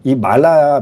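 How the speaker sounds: ordinary delivery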